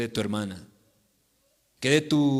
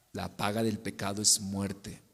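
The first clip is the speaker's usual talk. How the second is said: much softer, lower grave repetition; hushed solemn verse; 7 semitones lower, 8 dB quieter than usual. Real, not acted. Preacher answers the objector, hushed and low. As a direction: drop to a grave hush. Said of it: "verse repeated as refrain".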